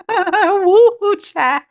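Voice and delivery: high "woo" breaking out of laughter; laughing exclamation. Real, not acted. Host echoing her sister's phrase, laughing exclamation. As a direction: whoop it through a laugh. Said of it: "let's say it together".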